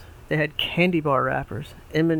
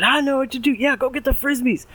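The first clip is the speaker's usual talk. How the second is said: put-on high excited kid voice; 11 semitones higher, 4 dB louder than usual. Oliver excited.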